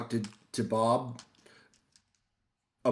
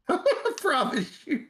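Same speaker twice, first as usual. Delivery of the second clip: high, laughing insistence; slow, giggly protest; slower than usual. Real, not acted. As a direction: squeeze the words out through laughter. Laughing at own joke.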